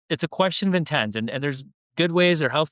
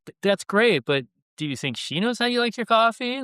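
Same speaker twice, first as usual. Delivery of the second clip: incredulous retort; 9 semitones higher than usual. Retold or imitated. Retold pointed question to captain.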